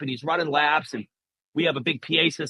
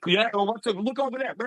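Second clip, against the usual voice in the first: higher-pitched fake casual patter; mock distraction; 6 semitones higher than usual. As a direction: imitate someone diverting a child's attention. Distracting a kid before sneak attack.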